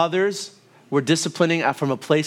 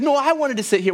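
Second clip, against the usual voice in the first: higher-pitched, lightly voiced little boy; whiny son; 9 semitones higher, faster than usual. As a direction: play the boy pleading his case. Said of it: "She's like".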